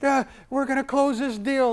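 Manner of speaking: hammed-up pushy pitch to a cautious client; mock pushy salesman. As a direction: overdo the eager closer. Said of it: "the S's are not gonna like".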